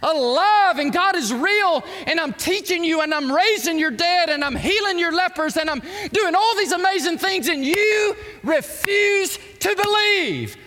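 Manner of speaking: loud, high, fervent preaching as Jesus; booming preacher voice for Jesus. Acted. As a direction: thunder it out as Jesus rebuking the crowd. Jesus voiced, preaching full force.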